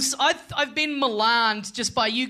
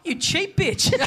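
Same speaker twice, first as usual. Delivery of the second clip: giggling mock insult; laughing jab at a cheap friend; 8 semitones higher, slower than usual. Real, not acted. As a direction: tease through laughter. Teasing co-host over re-gift.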